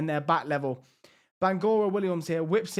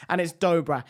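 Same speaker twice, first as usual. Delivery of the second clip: ordinary delivery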